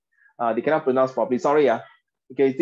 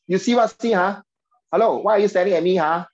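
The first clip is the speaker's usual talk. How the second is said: rude Singlish; 5 semitones higher than usual. Shown as a demonstration. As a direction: snap it like a Singaporean ah beng picking a fight. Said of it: "Like, very, very Singlish".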